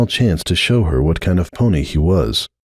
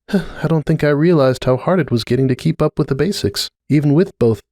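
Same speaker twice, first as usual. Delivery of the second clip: higher, lightly voiced tired mother; a lighter, higher voice for the worn-out mare; Cupcake voice; 7 semitones higher than usual. A narrator's reading. Cupcake admitting to Starlight how hard things got, a higher, lightly voiced tired mother.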